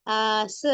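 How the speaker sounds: long held aa in आस, then s; stretched alif madd. Shown as a demonstration. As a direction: draw out the opening vowel of आस. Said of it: "क्या पढ़ रहे हैं इसको".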